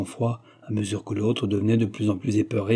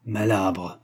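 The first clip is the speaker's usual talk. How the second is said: contemptuous insult, held down; dully voiced scorn; muffled, dull voice. A narrator's reading. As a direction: throw the insult out under your voice. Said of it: "il lui lança d'une voix sourde".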